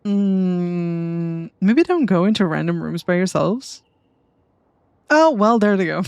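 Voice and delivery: ordinary talk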